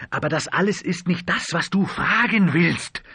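plain reading voice

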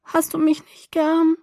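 high, slow, plaintive question; pitiful, hurt little plea; sad pleading. A narrator's reading. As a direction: ask it sadly in a high, drawn-out voice. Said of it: "fragte sie in demselben traurigen Ton".

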